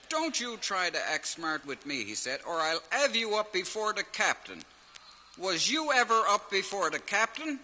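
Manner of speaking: a hint of a barking officer; stern officer. Officer scolds George.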